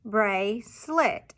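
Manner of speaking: "bracelet" slowly split into brace and let; two separate beats in "bracelet"; chopped syllables. Shown as a demonstration. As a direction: chop "bracelet" with a gap between syllables. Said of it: "they would chop bracelet".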